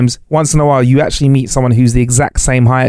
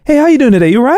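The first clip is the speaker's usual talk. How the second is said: high, over-friendly greeting; mock cheery greeting; 11 semitones higher, faster than usual. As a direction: parody a chirpy stranger. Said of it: "you wouldn't get anywhere".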